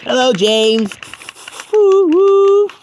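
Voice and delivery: very high, loud imitated whistle; whistle toot. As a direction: woo woo like an engine whistle. Whistle greeting at docks.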